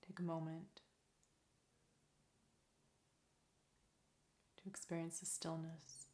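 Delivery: soft, calm meditation voice with long pauses; guided meditation. Real, not acted. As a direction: lead them gently into stillness, leaving space between phrases.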